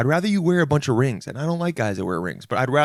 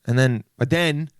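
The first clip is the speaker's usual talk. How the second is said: usual speaking voice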